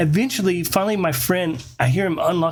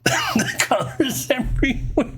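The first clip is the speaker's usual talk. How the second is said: higher, slow words through laughter; laughing; 10 semitones higher, slower than usual. Real, not acted. Storyteller recalling flashing police lights, laughing through the words.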